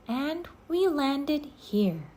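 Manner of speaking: put-on a little girl repeating; Annie voice. A narrator's reading. Annie echoes Jack.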